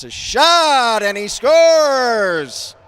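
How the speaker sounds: huge, high, drawn-out shout; sportscaster erupting as the puck goes in; excited goal call. Real, not acted. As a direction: belt out the goal, stretching the words.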